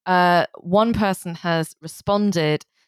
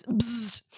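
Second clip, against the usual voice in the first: buzzing zap noise, quiet; a short electric bzzzt; bug zapper zap; 5 semitones higher, 9 dB quieter than usual. Acted. Buzzer after reading a point.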